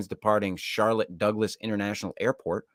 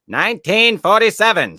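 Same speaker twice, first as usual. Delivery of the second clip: dramatic exclaim; 11 semitones higher, 11 dB louder than usual. Acted. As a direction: belt the date out like a big reveal. Dramatic repeat of date.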